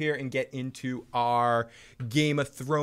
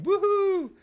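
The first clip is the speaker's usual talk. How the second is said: high, bright woohoo cheer; excited whoop; 3 dB louder than usual. Real, not acted. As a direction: let out a happy whoop. Cheer for sativa time.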